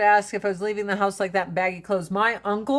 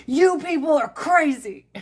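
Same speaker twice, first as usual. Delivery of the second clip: uncle ranting; 5 semitones higher than usual. Retold or imitated.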